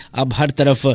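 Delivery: usual speaking voice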